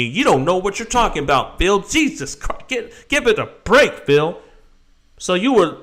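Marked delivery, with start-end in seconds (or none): 0.0-4.4 mocking critic. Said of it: "is telling me".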